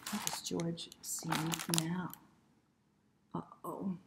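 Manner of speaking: usual speaking voice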